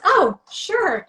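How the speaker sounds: high, bright little "oh, sure"; mock surprise. Retelling her reply to his drink request, mock surprised.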